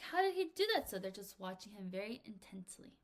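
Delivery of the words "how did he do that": amazed audience. Acted. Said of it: "everyone's like".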